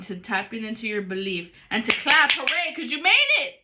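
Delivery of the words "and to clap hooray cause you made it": cheering. Acted. Celebrating success.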